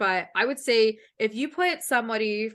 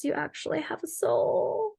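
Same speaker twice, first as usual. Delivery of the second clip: nasal mock accent; 6 semitones higher than usual. Podcaster mocks an LA voice, slow and nasal. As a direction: squeeze it through the nose, unimpressed. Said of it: "they're like".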